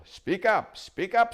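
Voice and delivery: put-on high, loud cranky old dwarf; deaf old dwarf. Dwarf cupping his ear at the owl, loud and cranky. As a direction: bark it like a deaf old man.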